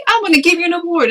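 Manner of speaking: very high, quick imitation; high-pitched excited announcer quote; award giver. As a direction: pipe up eagerly with an offer. Voicing people offering an award.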